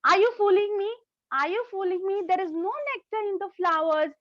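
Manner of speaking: high-pitched acted indignation; angry. Samaira accusing the butterflies of tricking her, cross and high. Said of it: "She gets very angry at the butterfly".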